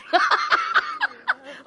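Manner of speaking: high contemptuous cackle; derisive laugh. Acted. Henrietta deriding Carlos, contemptuous laugh. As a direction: scoff at the very idea.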